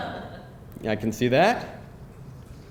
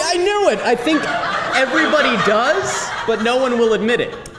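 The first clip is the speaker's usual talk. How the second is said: laughing; 10 semitones higher, 4 dB louder than usual. Real, not acted. Still laughing at confession.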